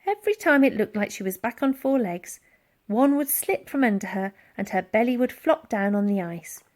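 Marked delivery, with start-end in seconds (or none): none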